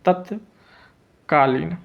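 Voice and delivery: तत्कालीन slowly, a long gap after तत्; measured spelling-out of तत्कालीन; slow dictation. Shown as a demonstration. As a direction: split तत्कालीन into तत् and कालीन. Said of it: "तत्कालीन ऐसे लिखेंगे".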